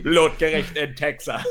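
slow, dramatic seventies trailer narrator; movie trailer voice. Retold or imitated. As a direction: intone the title like a trailer voiceover. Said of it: "alles klingt besser in der Stimme".

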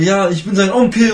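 high, loud shouted quote; retold shouting. Friend bursts into the teachers' room claiming kinship, retold shouting. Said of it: "Schreit so rum".

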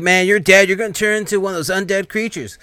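high, loud panicked cry; terrified warning about becoming undead; freaking out. Retold or imitated. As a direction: panic that your friend is turning. He starts freaking out.

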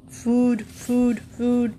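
short barked words; barking dog. Acted. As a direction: bark each word like a dog. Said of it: "barked Wag".

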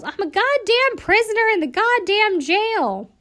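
high, loud angry yell. Retold or imitated. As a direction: yell it at her like an enraged inmate. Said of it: "and Eileen yells".

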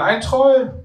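high, loud playful cry for help; a boy pretending to be scared; mock scared scream. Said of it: "schreit der Junge".

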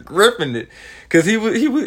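normal talking voice

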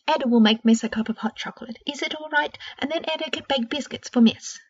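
put-on an old house elf offers to comfort Miss, high and eager; lightly squeaky elf.

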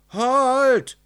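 high, loud shout; urgent, high call; calling after someone. A narrator's reading. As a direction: shout to stop someone walking off. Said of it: "rief es hinter ihm her".

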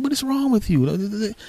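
mock bewildered, voice leaping high; high-pitched protest. Boyfriend defending himself to angry girlfriend, high-pitched protest.

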